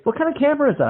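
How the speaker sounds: Randy asking about the camera, high and eager; curious rocker. Retold or imitated. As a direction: ask it like a starstruck fan.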